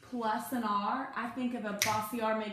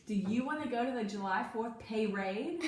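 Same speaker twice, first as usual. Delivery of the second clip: ordinary talk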